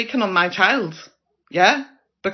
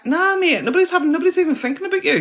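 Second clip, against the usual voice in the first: much higher, sharp "no, mate"; exasperated outburst; 10 semitones higher, faster than usual. Real, not acted. Vlogger shutting down a commenter, exasperated high outburst. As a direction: cut them off in disbelief, pitch shooting up.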